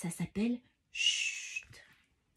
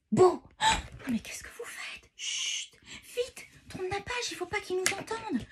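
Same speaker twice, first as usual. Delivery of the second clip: alarmed; 11 semitones higher than usual. Acted. Children make noise near giant.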